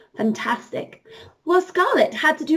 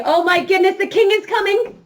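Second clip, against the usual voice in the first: high, loud alarm call; urgent warning; 6 semitones higher, 4 dB louder than usual. Pretend. Teacher warns children the king is coming, urgent call. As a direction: gasp and warn the king is here.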